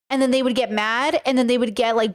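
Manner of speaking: ordinary talk, nothing marked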